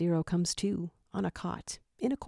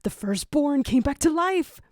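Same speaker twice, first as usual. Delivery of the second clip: loud, high, overjoyed shout; father rejoicing over his newborn, loud and high; joyous father; 8 semitones higher, 7 dB louder than usual. A narrator's reading. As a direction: burst out with joy. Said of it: "a joyous paternal voice".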